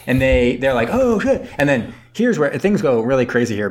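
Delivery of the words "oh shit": high laughing oh shit; laughing mock panic. Crew realises the Germans know, laughing high alarm.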